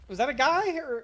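alarm shout. Real, not acted. Shocked after sudden kill.

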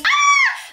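very high sharp shriek; sudden scream. Retold or imitated. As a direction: shriek like you got startled. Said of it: "she screams".

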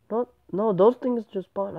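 a startled no, no. Real, not acted. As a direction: caught off guard by something that just appeared. Surprised by mobs suddenly appearing.

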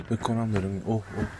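hushed dread. Real, not acted. Player hiding from the neighbour, hushed and tense. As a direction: hold your breath as he comes.

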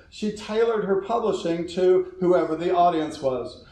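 ordinary talk

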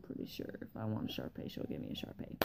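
soft whispered aside. Real, not acted. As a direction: whisper it under your breath.

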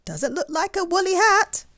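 high, loud bird voice; swoopy bird. A narrator's reading. Swoopy bird doubting it is a hat, high chirp.

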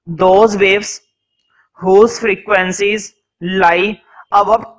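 very slow, chunked dictation voice. Real, not acted. Teacher dictating a definition to students, slow dictation. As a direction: dictate word by word, pausing for writers.

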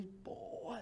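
whispered, drawn-in amazement; hushed swearing. Retold or imitated. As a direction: swear under your breath, impressed. Hushed awe on seeing Neymar. (palavrão sussurrado)

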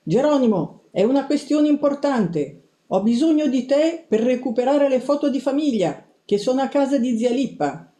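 insistent high-pitched sister voice; high, pleading insistence; Tea. A narrator's reading. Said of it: "mia sorella insistette".

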